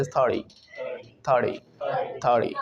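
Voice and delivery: "थर्टी" as "thur-dee"; T as light D. Shown as a demonstration. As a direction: soften the T in "थर्टी" to a tapped D. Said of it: "टी में हल्का सा डी add हो जाता है".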